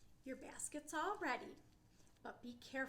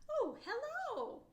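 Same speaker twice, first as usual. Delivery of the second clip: put-on surprised girl; 13 semitones higher, 8 dB louder, slower than usual. Teacher voicing girl meeting bear.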